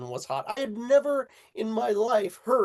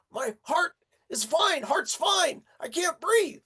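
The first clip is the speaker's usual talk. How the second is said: panicked shout; 10 semitones higher than usual. Retold or imitated. Friend panicking about breathing.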